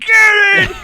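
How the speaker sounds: a loud, high, victorious yell; triumphant shout. Retold or imitated. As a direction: shout it like you just won. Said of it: "I was like".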